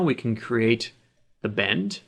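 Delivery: usual speaking voice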